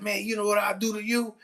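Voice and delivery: student threatening the teacher, high menacing voice. Retold or imitated. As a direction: imitate a kid making threats. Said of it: "He said".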